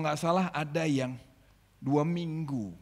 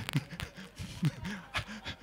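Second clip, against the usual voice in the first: soft laugh; 3 semitones higher, 6 dB quieter than usual. Real, not acted. Laughs at own imitation.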